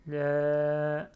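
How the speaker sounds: "la" fort, voyelle longuement tenue; étirer le a de "la" sans varier la hauteur; prolongé. Shown as a demonstration. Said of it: "j'ai prolongé". (« la » long et soutenu)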